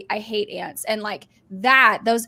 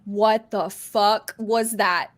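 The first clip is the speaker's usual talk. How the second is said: drawn-out, word-by-word disbelief; slow disbelief; much slower than usual. Retold or imitated. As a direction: stretch each word in stunned disbelief. Acted disbelief at the film.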